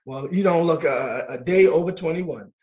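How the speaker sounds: ordinary talk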